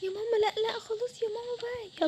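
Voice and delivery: acted whiny, stretched-out plea; pleading child. Child begs while being dragged off.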